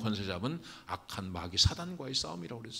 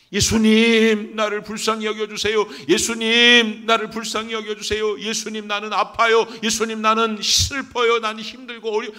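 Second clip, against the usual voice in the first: high, very loud pleading; desperate crying out; 10 semitones higher, 14 dB louder than usual. Acted. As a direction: shout a prayer of pain at full voice. 예수께 부르짖는 기도 시범. (고통 속에 크게 부르짖는 기도)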